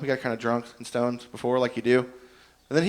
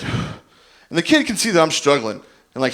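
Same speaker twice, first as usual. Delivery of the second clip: ordinary talk, nothing marked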